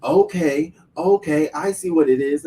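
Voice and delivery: high-pitched indignant outburst; worked-up, accusing mock yell; imitated yelling. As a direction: mimic someone yelling as he catches you out. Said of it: "he started yelling my real name".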